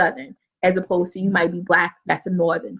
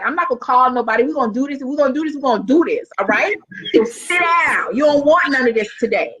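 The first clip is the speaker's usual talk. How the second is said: firm warning; 5 semitones higher than usual. Retold or imitated. Facing down a student.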